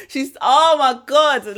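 loud, drawn-out laughing exclamation; laughing in happy disbelief; overcome with delight. Real, not acted. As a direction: burst out laughing, stretching the words. Overcome describing the child's video.

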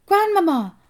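very high, loud call; shouting. A narrator's reading. Boy up a tree to his grandmother, shouting. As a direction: yell for someone far below. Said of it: "I shouted".

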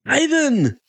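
high-pitched excited call; yelled. A narrator's reading. Fan blurting out the wrestler's first name, yelled. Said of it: "I yelled".

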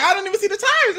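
high mock confused voice; confused contestant. Recapping the show, high imitation of a confused contestant. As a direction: squeak it out like a baffled teammate.

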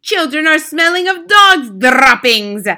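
high, loud screech. Acted. Grand High Witch screeching at the crowd, shrill. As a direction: screech it in disgust. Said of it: "screeched the Grand High Witch".

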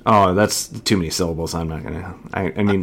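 normal talking voice

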